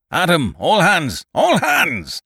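booming, high roar of command; thundering roar. A narrator's reading. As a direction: roar it like thunder over the fight. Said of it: "he roared in a voice of thunder".

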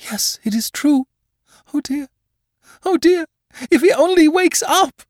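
very high and loud, pleading cry; desperate mother. A narrator's reading. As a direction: cry out in fear for her son. Mother in despair.

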